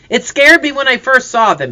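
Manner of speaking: high, loud, shaken-up report; agitated. A narrator's reading. Hunter back from running to the others, agitated. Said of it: "He was breathing hard".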